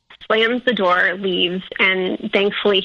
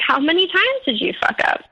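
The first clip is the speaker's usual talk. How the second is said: retold confrontation, pitched up; accusing question; 8 semitones higher than usual. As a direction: demand an answer from the cheater. She confronts the cheating boyfriend.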